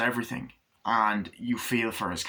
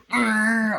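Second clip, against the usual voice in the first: higher, louder growled engine noise; engine roar; 12 semitones higher, 7 dB louder than usual. Acted. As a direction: rev like a souped-up car. Engine noise during the chase.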